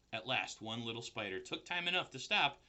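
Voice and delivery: usual speaking voice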